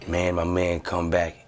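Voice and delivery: ordinary delivery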